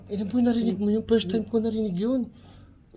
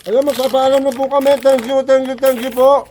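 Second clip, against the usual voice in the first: loud, quick and higher goodbye; cheery sign-off; 6 semitones higher, 9 dB louder than usual. Real, not acted. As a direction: bounce out of the video. (masiglang paalam)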